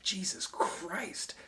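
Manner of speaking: slow, breathless whispered oath; shocked whisper. Retold or imitated. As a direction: gasp it out under your breath at the price. Customer shocked at frappe price.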